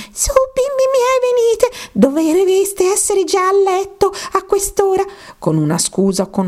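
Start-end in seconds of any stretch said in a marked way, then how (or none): 0.1-5.0 very high canary calling her chicks; the mother canary hurries her little ones home; trembling voice. A narrator's reading. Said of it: "chiamò con voce tremante". (canarina che chiama, voce acutissima e tremante)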